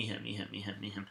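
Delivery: normal talking voice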